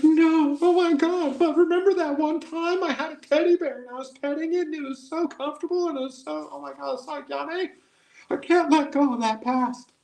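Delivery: put-on very high, childish whine clinging to a teddy bear; high squeaky mock voice. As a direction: squeak it out like a whiny kid. Mocking a clinging listener.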